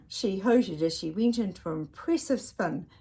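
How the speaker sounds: usual speaking voice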